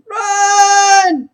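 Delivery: high, loud cry to run; a sudden high shout to flee; urgent shout. Acted. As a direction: yell at them to get moving. Character urges fleeing party.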